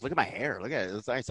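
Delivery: soft, proud friend quote; quiet. Retold or imitated. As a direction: softly show off your hair. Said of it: "She goes".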